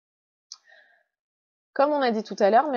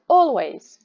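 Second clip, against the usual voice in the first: "always" with a strong, louder first syllable; stress on first syllable; 6 dB louder than usual. Shown as a demonstration. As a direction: hit the start of "always", let the second syllable drop. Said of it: "gros rond, petit rond".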